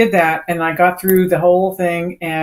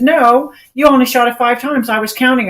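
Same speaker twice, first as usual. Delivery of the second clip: higher, louder acted contradiction; emphatic rebuttal; 7 semitones higher, 5 dB louder than usual. Range officer refusing the shooter's question about a double, emphatic rebuttal. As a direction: flatly deny it, re-enacting the official.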